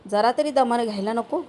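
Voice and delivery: ordinary delivery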